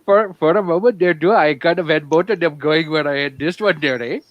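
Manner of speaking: hammed-up Yooper Wisconsin accent, "you betcha there, eh?". Bragging about deer camp to friends, Yooper accent.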